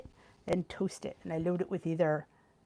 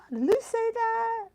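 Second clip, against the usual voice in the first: surprised; 13 semitones higher, slower than usual. Real, not acted. Surprised by a chat comment.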